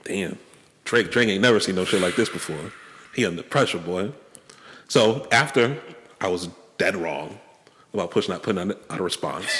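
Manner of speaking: ordinary talk, nothing marked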